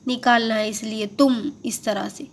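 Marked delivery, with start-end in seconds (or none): 1.2-1.3 تم with a held hummed M; M ending. Shown as a demonstration. Teacher showing learners the small meem, ending in M. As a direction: let the M ring at the end of تم. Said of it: "ہمیں اس کی آواز نکالنا ہے".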